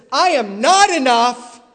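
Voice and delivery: "not" hit loud in "I am not enough"; stress on NOT. Shown as a demonstration. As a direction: lean hard on "not". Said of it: "the point of this verse is".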